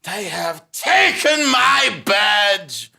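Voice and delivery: slow, loud furious declaration; defiant shout. Acted. Stripped officer defying his loyal clerk, slow loud outrage. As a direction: bellow it in outrage.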